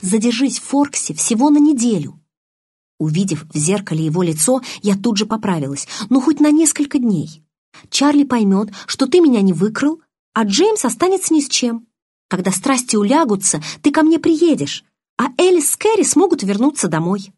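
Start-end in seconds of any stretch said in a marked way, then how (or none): 0.0-2.2 убеждающе, выше обычного; уговаривай изо всех сил; убедительно. A narrator's reading. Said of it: "звучал как можно убедительнее". 6.1-17.3 высоко и громко, упрашивая; тяни голос вверх, умоляя. A narrator's reading. Белла уговаривает Эдварда, заискивая.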